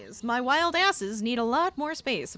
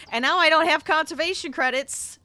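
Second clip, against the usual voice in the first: loud, high-pitched venting; worked-up complaint, breaking into a laugh at the end; exasperated; 6 semitones higher, 9 dB louder than usual. Real, not acted. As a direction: raise the pitch and volume in real frustration. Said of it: "I wanna scream".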